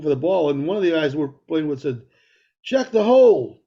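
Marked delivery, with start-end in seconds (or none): 2.6-3.6 calling out. Retold or imitated. Retold golf buddy call.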